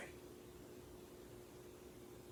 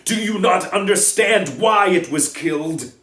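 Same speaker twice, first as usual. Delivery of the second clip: loud, higher, passionate defiance; furious defiance; 5 semitones higher, 13 dB louder than usual. Acted. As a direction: stand against Bane with full force. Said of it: "Firenze bellowed at Bane".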